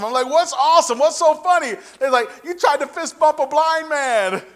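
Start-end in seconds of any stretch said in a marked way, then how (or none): 0.3-1.7 loud, high-pitched retold questioning; baffled demand. Acts out his baffled demand. 2.4-4.4 high, loud, drawn-out punchline; incredulous exclamation. Retold or imitated. Acts out friends revealing the punchline.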